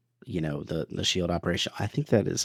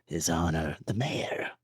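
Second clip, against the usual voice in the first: softer whispered sinister Emperor; 4 semitones lower, 7 dB quieter, slower than usual. Acted. Cohost reading patron names as the Emperor. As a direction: hiss it out as a dark lord. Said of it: "Let's do it in Emperor voice".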